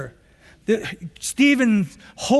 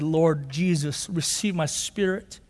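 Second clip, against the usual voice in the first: hushed dying prayer; 6 semitones lower, 6 dB quieter than usual. Acted.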